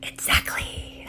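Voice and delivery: a soft whispered reply from the planet; Mercury whispering. Acted. Mercury answering the panicking ego, whispered. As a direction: breathe it as a knowing whisper. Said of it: "And Mercury's like".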